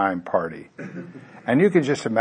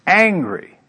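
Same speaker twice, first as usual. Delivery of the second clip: Angry said higher and louder; emphatic repeat; 8 semitones higher, 7 dB louder than usual. Shown as a demonstration.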